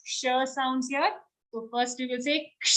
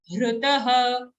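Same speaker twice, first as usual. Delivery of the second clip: usual speaking voice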